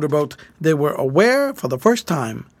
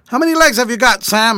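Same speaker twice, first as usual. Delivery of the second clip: higher, louder, abrupt question; 6 semitones higher, 5 dB louder than usual. A narrator's reading. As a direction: put the question abruptly, pitched up and loud. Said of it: "asked George abruptly".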